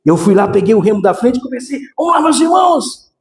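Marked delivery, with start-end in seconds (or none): 2.0-3.1 calling out. Retold or imitated. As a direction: call out cheerfully to the brothers. Greeting the brothers in the dream.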